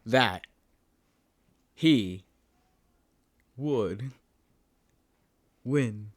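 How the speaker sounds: each word slow with long pauses; drawn-out reading. Retold or imitated. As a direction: drag out every word. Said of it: "I feel like I have to be like".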